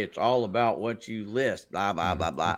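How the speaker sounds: low, slow mock voice; mocking a know-it-all. Host mimicking an absent seller's stock advice, mocking. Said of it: "if you were to ask this guy right here".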